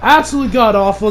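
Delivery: ordinary talk, nothing marked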